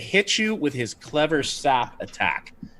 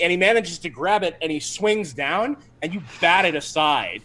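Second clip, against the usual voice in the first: usual speaking voice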